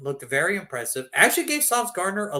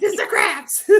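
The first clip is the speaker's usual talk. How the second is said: high, loud cartoon shout; mock Mr. Krabs; 11 semitones higher, 6 dB louder, slower than usual. SpongeBob fan calling out to Mr. Krabs, high mock shout. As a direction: call out like a cartoon crab. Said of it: "that SpongeBob episode".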